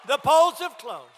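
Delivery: hammed-up election night announcer; mock announcer. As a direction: boom it out like a TV anchor calling a win. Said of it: "they said".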